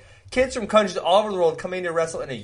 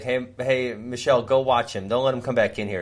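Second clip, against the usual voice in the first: ordinary delivery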